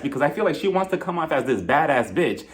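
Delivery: ordinary talk, nothing marked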